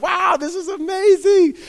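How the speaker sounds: mock wonder in the stands; amazed fan. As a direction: marvel at the catch like a thrilled fan. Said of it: "And I'm like".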